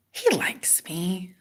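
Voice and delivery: slow, soft whisper; hushed retold conviction; whispered insistence. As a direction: whisper it slowly to yourself. Acting her own insistence.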